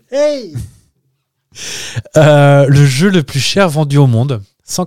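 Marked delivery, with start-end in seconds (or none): 0.1-0.5 sudden much higher yelp; Jean Benguigui shout. Retold or imitated. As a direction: fling out a high hey. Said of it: "j'ai fait un Jean Benguigui de loin".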